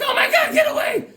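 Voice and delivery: high laughing mock shriek; mock scream. Barbarian fleeing the lizard man, hammed-up laughing shriek. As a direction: squeal in fake terror and laugh. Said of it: "He's like".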